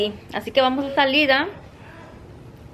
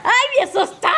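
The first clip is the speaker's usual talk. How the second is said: higher, breathless after a fright; real startled exclamation, pitched up; 11 semitones higher than usual. Real, not acted. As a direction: still catching the scare. Just got startled.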